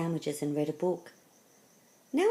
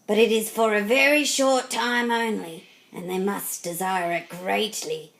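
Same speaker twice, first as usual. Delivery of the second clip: louder, emphatic gargoyle giving a caution; lightly voiced gargoyle; 3 semitones higher, 5 dB louder than usual. A narrator's reading. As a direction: lightly play a gargoyle laying down conditions. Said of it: "said the gargoyle".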